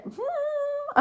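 very high, dubious hmm; a wincing judgemental hum; high doubtful hum. Real, not acted. Reacting to a bad accent, high doubtful hum.

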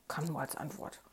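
plain reading voice

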